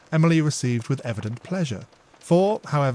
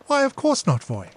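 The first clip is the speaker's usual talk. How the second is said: sweet assent; 12 semitones higher, 4 dB louder than usual. Acted. Emily answers sweetly.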